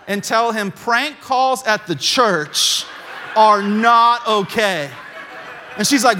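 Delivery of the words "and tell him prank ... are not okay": slow, deliberate emphasis for comic payoff; deadpan firm reprimand. Retold or imitated. Pastor telling secretary about his dad's prank calls, deliberate mock-stern delivery. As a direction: spell it out slowly, louder at the end.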